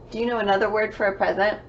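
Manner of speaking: ordinary delivery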